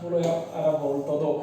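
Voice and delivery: normal talking voice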